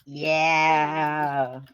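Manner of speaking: long, loud demonic yeah; demonic layered voice. Acted. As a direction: let it roar out like a demon. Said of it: "I have regained my demon voice".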